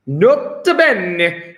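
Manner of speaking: put-on slow, drawn-out Italian accent. Teacher showing off a favourite Latin note, in an Italian accent. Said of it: "Gotta say it with an Italian accent".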